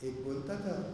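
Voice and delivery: usual speaking voice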